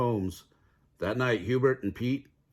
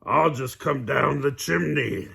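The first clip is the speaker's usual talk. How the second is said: louder sly wolf voice; cunning wolf; 4 semitones higher, 4 dB louder than usual. A narrator's reading. Wolf on the roof, slyly plotting. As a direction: scheme out loud.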